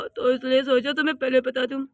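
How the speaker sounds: ordinary delivery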